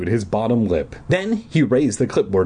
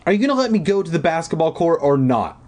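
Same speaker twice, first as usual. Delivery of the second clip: fast, higher-pitched demand; impatient retort; 8 semitones higher, faster than usual. A narrator's reading. Brother wants past his sister, impatient retort. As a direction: rush it out, fed up. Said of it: "Elliot shot back".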